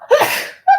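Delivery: a sudden high cough; sharp cough. Real, not acted. Coughing mid-stream, then excusing herself. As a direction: cough once, hard. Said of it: "Excuse me".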